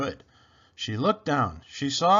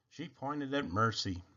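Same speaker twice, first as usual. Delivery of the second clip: ordinary delivery, nothing marked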